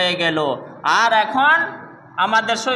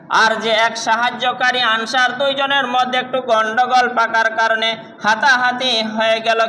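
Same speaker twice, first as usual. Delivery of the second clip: normal talking voice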